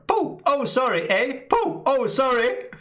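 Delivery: high, bouncy mock sniper, a shot then an apology; mock polite Canadian sniper. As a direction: play a sniper who shoots, then apologizes sweetly. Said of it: "Can you imagine the Canadian snipers".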